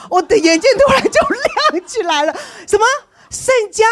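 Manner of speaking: high and loud, eyes lighting up; amazed. Retold or imitated. Retelling to the congregation the moment God's sign came, amazed. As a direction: gasp it out in sudden wonder.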